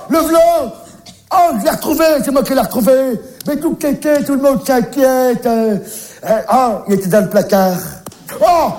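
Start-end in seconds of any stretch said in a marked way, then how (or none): 0.1-5.5 high, delighted; excited relief. Acted. Babysitter shouting he found the boy, excited. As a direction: announce the find proudly.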